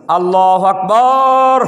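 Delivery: loud, slow, drawn-out preacher's Allahu Akbar; preacher's long takbir. Retold or imitated. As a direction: call it out like a fiery sermon preacher. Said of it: "অধিকাংশ বক্তারা আমরা শুনে দেখেছি".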